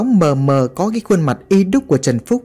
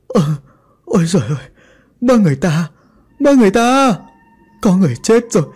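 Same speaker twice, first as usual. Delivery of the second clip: terrified scream; 8 semitones higher, faster than usual. A narrator's reading. Nanny finds the dead boy and screams for help, panic building. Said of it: "Bà ta vừa bò vừa hét".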